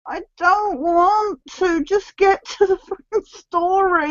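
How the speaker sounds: slow, bored whine; bored whining. Retold or imitated. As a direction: drag it out, fed up. Bored complaint.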